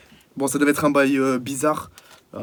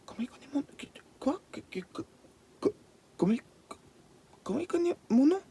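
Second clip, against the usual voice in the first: scared whisper; 11 semitones higher, 5 dB quieter, faster than usual. Acted. Scared by voice knowing name.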